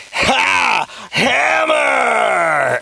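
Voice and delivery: high, hammed-up yell of Hammer mid-laugh; villain bellowing his own name while laughing; laughing shout of his name. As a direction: crow your own name like a cackling villain. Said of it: "And screaming his own name".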